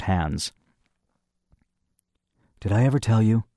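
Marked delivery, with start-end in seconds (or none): 2.6-3.4 husky tone. A narrator's reading. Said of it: "he began in a husky tone".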